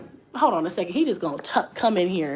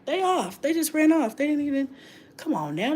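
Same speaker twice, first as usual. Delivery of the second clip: ordinary delivery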